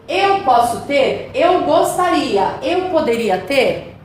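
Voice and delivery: slow, carefully spaced glosses; teacher slowly listing translations. Shown as a demonstration. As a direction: lay out each option slowly for students. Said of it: "são opções que você fala".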